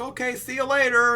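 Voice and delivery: high-pitched cartoon buddy voice; chummy farewell. Hachi dropping off his new buddy Zoro, hammed-up chummy voice. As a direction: sing out a friendly goodbye, put on. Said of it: "they're like buddies".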